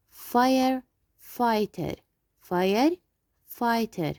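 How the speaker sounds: Firefighter said slowly, with silent g and h; slow, careful firefighter. Shown as a demonstration. Teacher slowly models firefighter for the class. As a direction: stretch out each syllable of Firefighter. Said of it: "We will not pronounce G and H".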